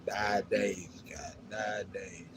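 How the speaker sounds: ordinary delivery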